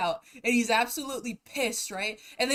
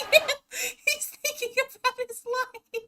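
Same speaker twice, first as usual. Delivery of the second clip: high, laughing through sobs; words choked by laughter; real laughing fit about the video; laughing to tears; 14 semitones higher, 5 dB louder than usual. Real, not acted. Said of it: "I'm in tears".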